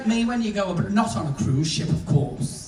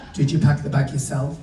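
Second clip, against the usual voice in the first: lightly voiced snotty desk clerk, lower pitch; dead snotty; 7 semitones lower than usual. Check-in woman questions a passenger, mildly snotty. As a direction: question them low with a touch of snootiness. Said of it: "she's dead snotty".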